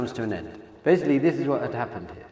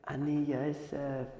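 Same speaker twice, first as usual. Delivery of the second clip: slow, much softer quoted revelation; hushed reveal; 10 dB quieter, slower than usual. Retold or imitated. Voicing Hashem revealing Himself at the end, hushed. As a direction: draw it out softly, as a solemn disclosure.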